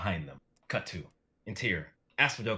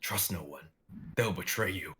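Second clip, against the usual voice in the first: whispering demon. Acted. Demon voices whispering to Nick.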